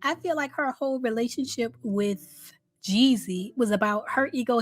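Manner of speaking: ordinary delivery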